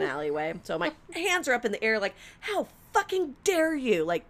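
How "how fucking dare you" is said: high, slow indignant demand; hammed-up indignation, pitched up; mock outrage. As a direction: draw it out in acted affront. Acting outrage, hands in air.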